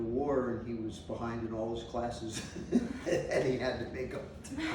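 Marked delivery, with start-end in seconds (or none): 2.7-4.7 chuckling through the words; amused, laughing while recounting. Real, not acted. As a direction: laugh as you tell it. Friend's grades ruined, amused.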